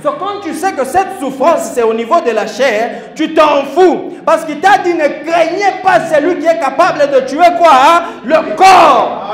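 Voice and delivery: loud, rising preacher's cry; fiery preaching. Acted. Preacher shouting to the congregation about the body, loud and fervent. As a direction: call it out to the whole church.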